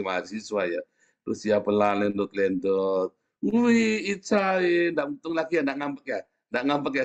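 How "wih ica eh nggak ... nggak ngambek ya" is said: high cooing tone sliding down; coaxing a child. Retold or imitated. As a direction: coo at a sulking child, starting very high. Retold coaxing of a sulking child. (membujuk anak dengan suara tinggi)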